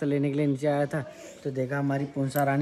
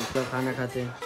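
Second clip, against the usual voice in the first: ordinary delivery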